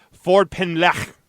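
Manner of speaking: "Ford Ben Lech" slow, with a guttural final ch; careful Welsh pronunciation. Shown as a demonstration. Host showing how locals say the street, "Ford Ben Lech" carefully pronounced, guttural ch. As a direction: sound out "Ford Ben Lech" slowly, throaty ch at the end. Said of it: "I believe that's how they pronounce it".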